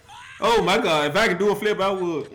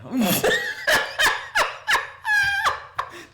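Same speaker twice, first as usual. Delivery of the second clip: high squealing laugh. Real, not acted. Laughing at Brady sitting out.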